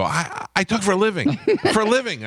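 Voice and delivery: high-pitched affronted retort; indignant protest. Retold or imitated. Husband answering wife's mumbling complaint, high indignant protest. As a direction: insist on it, voice jumping up.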